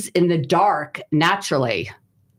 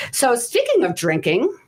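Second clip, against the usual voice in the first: ordinary talk, nothing marked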